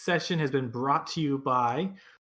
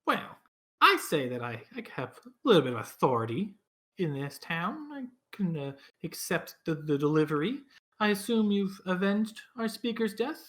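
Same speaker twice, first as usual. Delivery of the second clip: put-on higher, fast-talking elderly woman; a town elder with some authority; old lady voice; 5 semitones higher than usual. GM voices Olivessa.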